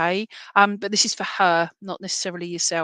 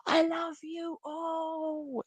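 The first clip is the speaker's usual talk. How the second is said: high, soft, drawn-out croon; sister's loving call; 9 semitones higher, 7 dB quieter, much slower than usual. Retold or imitated. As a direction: stretch each word out softly, up high. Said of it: "is what she's doing".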